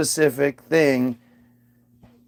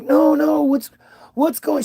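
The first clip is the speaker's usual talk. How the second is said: high-pitched mock pestering; imitated insistent mom; 10 semitones higher than usual. Mom who keeps bugging departing dad, imitated insistence. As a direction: jump up high, imitating someone who won't let go.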